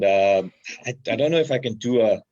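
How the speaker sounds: ordinary talk, nothing marked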